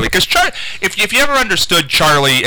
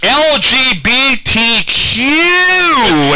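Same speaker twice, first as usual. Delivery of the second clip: very slow, much higher, spelled out; imitating Charlie; 11 semitones higher, 4 dB louder, much slower than usual. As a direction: drag each letter out like an oblivious coworker. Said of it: "And he said".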